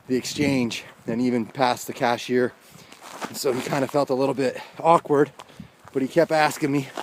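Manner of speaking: ordinary talk, nothing marked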